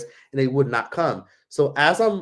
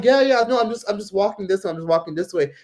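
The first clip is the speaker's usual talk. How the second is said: higher, hurried-sounding excuse easing down; nervous brush-off; 7 semitones higher than usual. Retold or imitated. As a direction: re-enact backing away politely. Acting out her nervous excuse.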